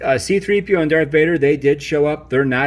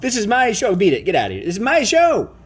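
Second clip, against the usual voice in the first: indignant yell; 7 semitones higher than usual. Acted. Toy boss ordering off rival.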